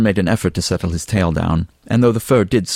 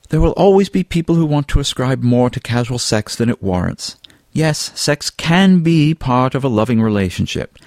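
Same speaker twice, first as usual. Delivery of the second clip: higher, emphatic lecturing; lightly voiced Fabian; 8 semitones higher, 3 dB louder than usual. A narrator's reading. Fabian lectures Alan on casual sex, higher and insistent. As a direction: plough on over any objection, pitched up.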